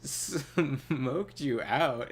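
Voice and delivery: slow, drawn-out disbelieving question; incredulous repeat. Retold or imitated. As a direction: stretch each word out in disbelief. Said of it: "I was like".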